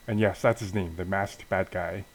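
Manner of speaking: plain reading voice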